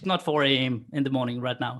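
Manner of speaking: normal talking voice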